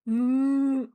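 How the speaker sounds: long, loud closed-mouth moo; cow moo. Shown as a demonstration. Streamer shows off animal noises, cow moo. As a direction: imitate a cow with a drawn-out mm. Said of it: "for cow".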